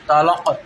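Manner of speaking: "তালাক" with an added echo vowel; qalqala pronunciation. Shown as a demonstration. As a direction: let the final k of "তালাক" bounce out. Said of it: "এরম করে উচ্চারণ করেন".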